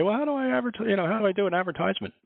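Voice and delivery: high, puzzled questioning voice; asking patron voice. Retold or imitated. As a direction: pitch up as a confused asker. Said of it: "very seldom do I see somebody come and say".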